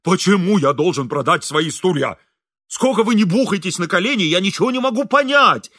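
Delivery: rising to a high, loud protest; exasperated shouting. A narrator's reading. Engineer refusing to sell his chairs, shouting. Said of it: "кричал инженер".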